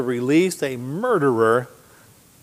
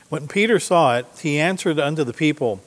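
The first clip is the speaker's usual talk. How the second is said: a raised, declaiming Bible reading; scripture reading; 3 semitones higher, 4 dB louder than usual. Acted. Preacher reading Peter's words to the congregation, declaiming. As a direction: proclaim the verse to the congregation.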